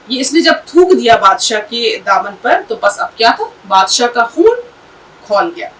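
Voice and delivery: plain reading voice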